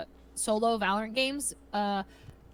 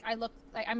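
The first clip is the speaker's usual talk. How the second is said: ordinary delivery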